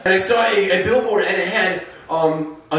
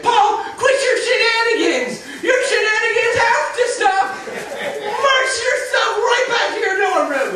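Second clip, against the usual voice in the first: much higher, slow mock scolding; mock yell; 14 semitones higher, slower than usual. Lunch monitor yelling at Paul, mock scolding. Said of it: "they would yell at me".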